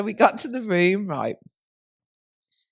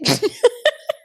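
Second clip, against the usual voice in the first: shrieking laugh; high squeal of laughter; 4 dB louder than usual. Real, not acted. Squealing laugh at the duck joke with her friend.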